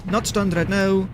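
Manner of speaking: ordinary talk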